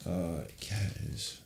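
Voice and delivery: slow, soft, mid-yawn words. Real, not acted. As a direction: stretch it through a yawn. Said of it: "Sorry, I'm yawning".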